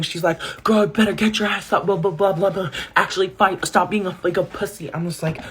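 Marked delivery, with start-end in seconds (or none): 0.6-4.9 higher-pitched mock yelling at a friend; egging her on in a high mock scream; retold screaming. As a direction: imitate a screaming friend without full volume. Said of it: "she's screaming".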